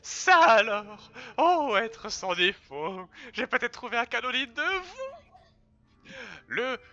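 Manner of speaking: high, drawn-out adoring creature voice; fawning character voice. Acted. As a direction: grovel in awe before the hero. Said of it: "adorer doubler ce perso".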